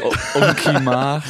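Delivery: slow, high teasing through laughter. Real, not acted. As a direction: tease the friend with the nickname. Teasing nickname.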